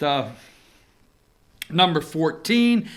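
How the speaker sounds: ordinary delivery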